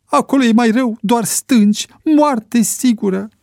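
high, loud cry of alarm; panicked warning. Acted. Panicked pilot rejects landing spot. (avertisment disperat, pe ton înalt)

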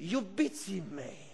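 ordinary talk

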